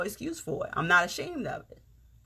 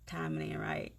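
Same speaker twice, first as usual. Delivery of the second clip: "Timing ain't right" repeated much softer, to herself; quiet echo; 6 semitones lower, 11 dB quieter than usual. Real, not acted. Telling viewers she can't say more yet, softly. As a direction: drop the volume and murmur "Timing ain't right". Repeats line, second time hushed.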